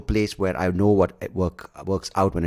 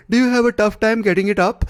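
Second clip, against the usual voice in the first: much higher, louder sleazy pitchman parody; mocking ad guy voice; 6 dB louder than usual. Parodying a creepy erection ad pitchman, high and loud. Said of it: "if I hear that voice".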